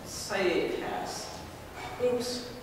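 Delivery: ordinary talk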